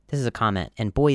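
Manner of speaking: ordinary talk, nothing marked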